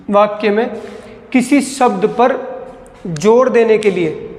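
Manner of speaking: slow and deliberate. Shown as a demonstration. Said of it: "सीधा सीधा question पूछा जा सकता है".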